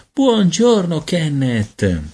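high, fluting cheerful greeting; flautato, allegro. A narrator's reading. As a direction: sing out a fond hello to a baby. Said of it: "voci flautate lo salutarono". (saluto allegro con voce flautata)

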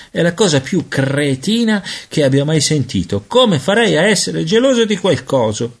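put-on high-pitched, outraged boy; indignant scorn. A narrator's reading. Peter scornfully rejects being jealous.

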